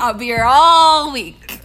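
comedian voice. Acted. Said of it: "comedian's stock line".

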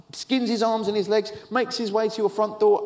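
ordinary talk, nothing marked

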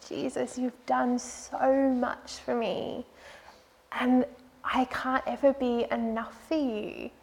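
soft, tearful prayer to Jesus; quietly weeping out the words; retold weeping prayer. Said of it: "I just wept 'cause I said".

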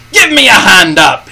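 angry pirate calling to be lifted; crying out. A narrator's reading. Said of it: "he cried".

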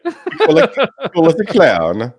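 high, loud goofy voice; mock dim designer. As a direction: play a clueless designer, grinning. Mocking the designers' brainstorm.

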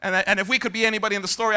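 ordinary talk, nothing marked

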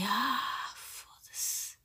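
breathless whispered curse; whispered thought. Retold or imitated. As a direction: mutter it under your breath. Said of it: "E pensei". (pensamento sussurrado)

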